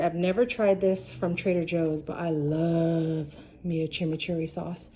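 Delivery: ordinary talk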